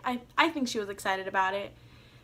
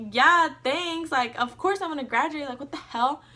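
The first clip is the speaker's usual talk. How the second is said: higher and louder, indignant comeback; offended retort; 4 semitones higher, 5 dB louder than usual. Retold or imitated. Retelling to mom, an indignant higher, louder comeback. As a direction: snap back, insulted. Mom doubts she'll graduate.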